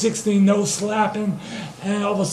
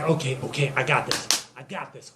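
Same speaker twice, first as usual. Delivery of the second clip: low, soft self-talk; psyching self up; 5 semitones lower, 7 dB quieter than usual. Acted. Joe steels himself for the job, hushed pep talk. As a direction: quietly talk yourself into it.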